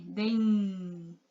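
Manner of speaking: Den softly, with its final nasal drawn right out; long held n. Shown as a demonstration. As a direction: let the n of Den hum on. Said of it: "Olha o som do N".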